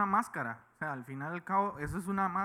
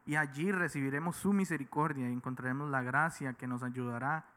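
scripture reading; 4 semitones lower than usual. Acted. Reads Hebrews 4:16 aloud.

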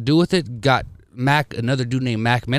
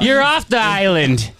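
game show host; 7 semitones higher, slower than usual. Pretend. Mock Survivor host elimination.

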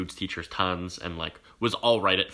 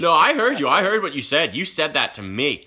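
high, loud insistent accusation; mock accusing sub; 7 semitones higher, 7 dB louder than usual. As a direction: play the sub pressing her charge. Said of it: "She was like".

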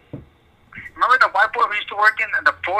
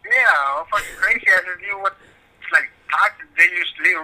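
ordinary talk

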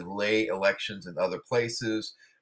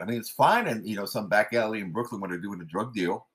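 ordinary delivery

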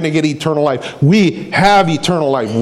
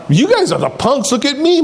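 lightly acted show-off, pitched up; mock boasting; 7 semitones higher than usual. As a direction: brag like you look down on everyone. Voicing boastful deal-takers.

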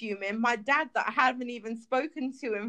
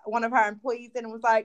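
ordinary talk

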